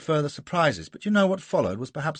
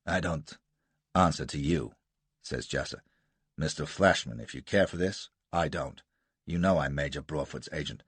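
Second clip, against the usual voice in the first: lightly voiced Jasser, lower, with a hint of American defiance; a cool, lower rogue brushing off the colonel; defiant American adventurer; 6 semitones lower than usual.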